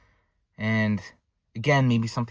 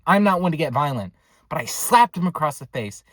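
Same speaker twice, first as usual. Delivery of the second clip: ordinary delivery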